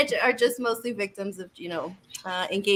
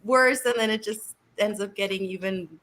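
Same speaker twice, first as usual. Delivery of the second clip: ordinary talk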